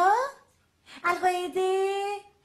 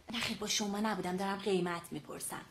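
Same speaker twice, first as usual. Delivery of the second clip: aside; 9 semitones lower, 9 dB quieter than usual. Acted. Aside to someone off the phone.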